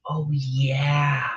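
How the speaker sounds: ordinary talk, nothing marked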